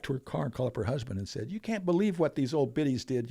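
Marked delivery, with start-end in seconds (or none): 1.5-3.3 gossiping complaint. Retold or imitated. Imagined gossip call to husband.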